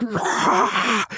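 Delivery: high, loud raging roar; demonic roar. Retold or imitated. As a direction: roar like a possessed man.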